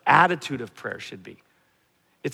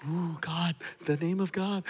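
hushed, higher, mock-reverent shiver; mock awe; 3 semitones higher, 6 dB quieter than usual. Someone awed by God's name, in hushed mock awe. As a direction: say it with exaggerated spooky reverence. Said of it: "It's like I start with this".

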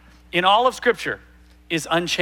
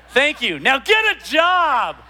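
raised pitch, drawn-out jeer with a laugh; mock insult; 6 semitones higher, slower than usual. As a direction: turn thanks into a jokey, drawn-out insult. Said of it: "into a kind of an insult".

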